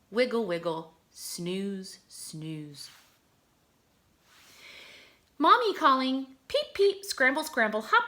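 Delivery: high, bouncy read-aloud of a duckling poem; expressive poem reading. Acted. Teacher reading duckling poem to her class, animated and sing-song. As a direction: act out each little word for the kids.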